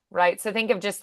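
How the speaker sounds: normal talking voice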